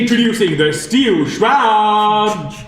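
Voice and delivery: slow, booming arena announcer over a speaker; crackly commentator's box PA voice; tinny announcer voice. Acted. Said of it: "tinny, uh, voice from a commentator's box".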